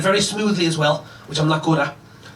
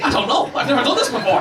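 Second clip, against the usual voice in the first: higher-pitched flustered protest; mock panic; 7 semitones higher than usual. Boyfriend answering his date after botched summons, mock panic. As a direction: plead helplessly, acting out the fluster. Panicked reply to puzzled girlfriend.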